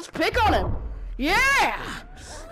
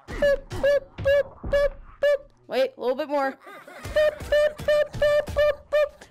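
high boop sounds; 12 semitones higher than usual. Pretend. Sound effects for hammering.

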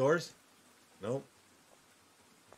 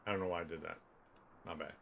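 much lower, quiet mumble; 10 semitones lower, 13 dB quieter, faster than usual. Real, not acted. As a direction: mutter it to yourself.

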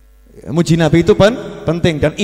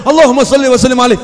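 fast, loud answering blessing; quick loud reply; 5 semitones higher, 6 dB louder, much faster than usual. Acted. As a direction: snap back the blessing at once.

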